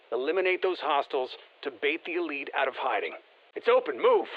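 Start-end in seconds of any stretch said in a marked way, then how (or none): 3.6-4.3 higher-pitched urgent call to move; urgent command. Acted. Teammate telling the squad it's open, urgent command. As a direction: urge them through the opening.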